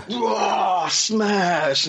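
slow-motion roar. Retold or imitated. Fan re-enacting Amazonians swinging giant hammers, slow-motion roar. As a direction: imitate a slow-motion smash, stretching it out. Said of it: "that's where slow motion comes in".

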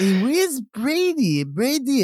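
high, slow, sing-song French voice; hammed-up French calling; French accent. Shown as a demonstration. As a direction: call out for Brady like a French nanny. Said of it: "doing the French voice".

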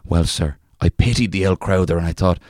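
reading a folk tale in a lightly put-on Irish accent. Reading an old folk tale to a co-host, in a light Irish accent. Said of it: "can I just say that accent".

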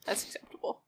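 whispered aside; whispering. Real, not acted. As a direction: whisper it under breath. Unvoiced aside.

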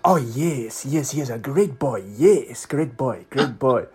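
put-on approving Nigerian-accented praise, falling tune. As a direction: imitate an emphatic Nigerian football fan. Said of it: "fantastic Nigerian guy".

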